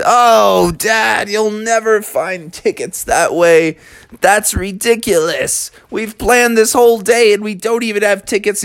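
put-on high, whiny kid voice; complaining kids. A narrator's reading. Kids doubting Dad's ticket plan, high whiny protest.

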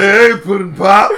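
slow goofy greeting; drawn-out silly greeting. Pretend.

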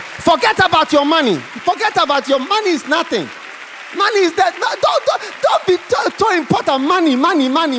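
loud, high, driving sermon cry; fiery preaching. Acted. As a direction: hammer it home from the pulpit. Preaching at full force.

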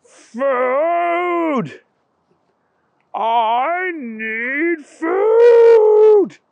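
high, drawn-out monster wail; blood-curdling zombie moan. Acted. The beast on the stairs moaning for food. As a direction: moan it like a starving ghoul. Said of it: "he heard the most blood-curdling".

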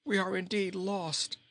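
high-pitched, hopeless groan; lightly acted despair of a beaten king; groaning dismay. A narrator's reading. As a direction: groan it as if all is lost. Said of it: "he groaned".

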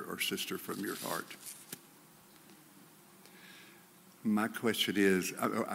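low, soft, intimate sermon voice; quiet earnest preaching. Acted. Preacher urging the church to forgive, soft and low. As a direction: lean in and speak softly to the congregation.

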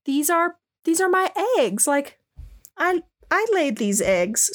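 high, tender cooing voice; high doting mother. Retold or imitated. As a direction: coo over them like a proud mom. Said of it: "She's like".

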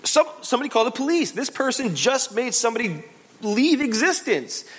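high, urgent mock panic; alarmed. A spooked onlooker demanding police, high alarmed voice. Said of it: "You would say".

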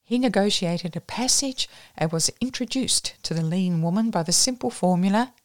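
ordinary delivery

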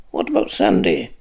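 low, cold and curt. A narrator's reading. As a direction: ask it coldly, flatly. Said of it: "asked Lanark coldly".

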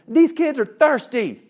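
loud, high, drawn-out griping; indignant complaint. Retold or imitated. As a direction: stretch it into a mock outburst. Retold indignant inner complaint.

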